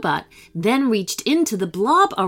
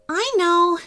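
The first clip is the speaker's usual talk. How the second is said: usual speaking voice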